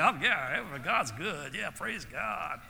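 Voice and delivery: a hint of a sugary churchgoer trailing off slowly; mock euphoric piety. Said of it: "people who always have this euphoric smile".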